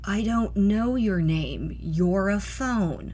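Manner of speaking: very slow and puzzled; dragged out, confused; slow, baffled. Acted. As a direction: spell it out to a phone that makes no sense. Sarah baffled by the phone.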